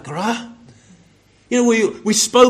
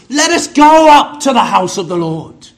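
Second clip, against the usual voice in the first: loud, ringing proclamation of the psalm; a proud, louder quote of the psalm; quoting the psalmist; 9 dB louder than usual. Retold or imitated. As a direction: call it out like David rallying the people. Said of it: "David said in the Psalms".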